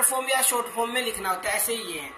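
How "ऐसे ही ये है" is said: ordinary talk, nothing marked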